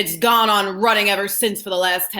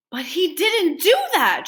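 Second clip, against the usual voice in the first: put-on higher, eager boy's voice; lightly voiced boy objecting; young Charlie; 9 semitones higher than usual. A narrator's reading. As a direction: protest like a curious child. Charlie's voice in story.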